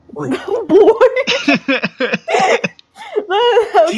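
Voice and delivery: high squealing laugh; cracking up, can barely talk; laughing hard. Real, not acted. Breaks up laughing.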